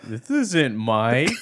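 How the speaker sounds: slow, lightly disguised prank-call voice; put-on caller voice, stretched out; imitated prank caller. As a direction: draw out a mock denial. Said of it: "He's like".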